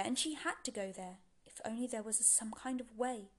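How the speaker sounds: ordinary delivery, nothing marked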